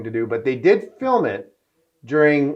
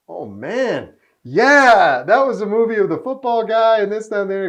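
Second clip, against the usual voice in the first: higher, louder tipsy rambling recall; excited mimic of Cuba; 8 semitones higher, 5 dB louder than usual. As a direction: mimic a tipsy star remembering, up high.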